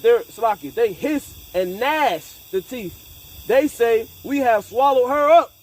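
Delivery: a rising street-preacher read; preaching scripture. Acted. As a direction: preach the verse out to the room.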